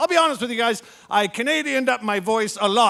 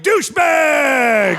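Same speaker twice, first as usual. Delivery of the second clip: drawn-out shout, slow and loud; a long, loud bellowed insult; shouted answer; 3 semitones higher, 5 dB louder, much slower than usual. Retold or imitated. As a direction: blurt it out like a drunk heckler. Blurting answer to embassy official.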